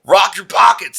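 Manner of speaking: high, insistent mock threat; retold robber's demand. Robber closes in on his victim, insistent mock threat.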